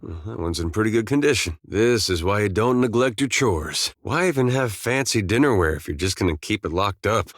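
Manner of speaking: usual speaking voice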